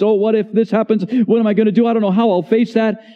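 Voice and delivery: a touch of hammed-up worry; anxious worrier.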